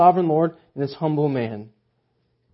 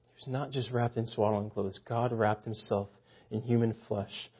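soft, awed hush; hushed awe; 6 semitones lower, 9 dB quieter than usual. Real, not acted. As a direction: speak it like a sacred secret. Awed reflection on incarnation.

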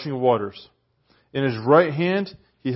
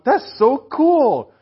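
high, slow, emphatic cool; stretched, thrilled "cool"; excited; 11 semitones higher, 5 dB louder, much slower than usual. Real, not acted. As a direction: marvel at it out loud. Excited reaction to scripture.

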